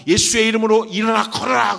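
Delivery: high, booming command; commanding shout. Acted. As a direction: order the lame man up with authority. 베드로의 명령을 재연. (권세 있게 외치는 명령)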